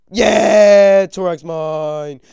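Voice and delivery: high, very loud victory shout; triumphant yell. Real, not acted. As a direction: roar in triumph, stretching the words. Celebrating a kill in game.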